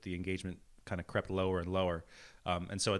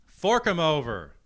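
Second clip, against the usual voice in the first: higher, louder greedy echo; 5 semitones higher, 6 dB louder than usual. Pretend. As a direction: shout it back greedily. Echoes the demand louder.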